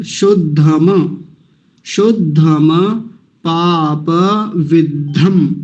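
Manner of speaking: शुद्धम पाप विद्धम drawn out slowly; slow recitation. Shown as a demonstration. Teacher modelling the mantra ending, slowly. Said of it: "ऐसा हो गया".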